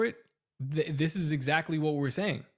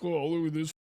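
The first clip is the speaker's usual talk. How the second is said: lightly put-on voice of a jeering critic; a hint of a mock heckler; mocking Mersher; 4 dB louder than usual. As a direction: imitate someone taunting a fired guy. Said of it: "the Mershers who are like".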